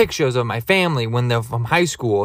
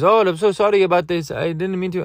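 lightly voiced driver, higher pitch; apologetic driver; 6 semitones higher than usual. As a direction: imitate a driver saying sorry. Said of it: "the guy starts talking to me".

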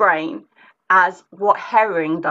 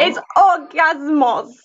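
drawn-out mock Greek accent, higher pitched; 7 semitones higher, slower than usual. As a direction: put on a playful Greek accent. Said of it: "That was my Greek accent".

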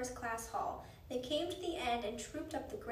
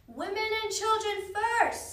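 high, loud, slow shout; drawn-out shouted announcement; crowd-call shout; 9 semitones higher, 8 dB louder, slower than usual. A narrator's reading. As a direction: call out an order across the deck. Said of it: "someone shouted".

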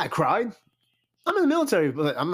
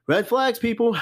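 higher, louder, punching out the warning; raised voice red flags; emphatic call-out; 8 semitones higher, 3 dB louder than usual. Real, not acted. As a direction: sound the alarm to the viewers.

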